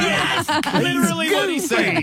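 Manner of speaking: very high, excited squeal through laughter; thrilled outburst. Real, not acted. Host confirming the answer matches, thrilled outburst. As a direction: squeal it, laughing, as the guess lands.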